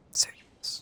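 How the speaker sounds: breathy whisper in awe. Real, not acted. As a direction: whisper it as the scene turns serious. Reacting to dramatic cutscene.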